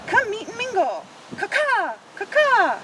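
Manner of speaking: high sing-song crow calling; playful crow inviting everyone to mingle. Retold or imitated. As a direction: call it out like a friendly crow. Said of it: "Crow calls out".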